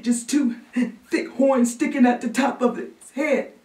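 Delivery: put-on high, gasping frightened child; trembling kid reliving a fright; shaking, scared boy. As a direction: catch your breath between phrases, building in panic. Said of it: "who was shaking like new jello".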